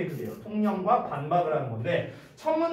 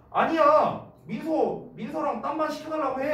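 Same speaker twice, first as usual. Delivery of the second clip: higher-pitched, insistent mock pleading; passionate protest; 6 semitones higher than usual. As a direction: object eagerly to talk them round. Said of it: "내가 또 막 열정적인 항변을". (목소리를 높인 열정적인 항변)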